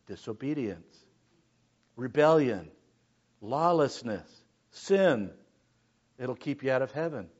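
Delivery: ordinary talk, nothing marked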